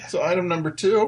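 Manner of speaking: announcer voice. Pretend. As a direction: announce the next segment. Announcing next topic.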